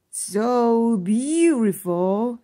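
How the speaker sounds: slow, wondering grandfather; awed grandpa. A narrator's reading. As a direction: savor the beauty. Grandpa marvels slowly.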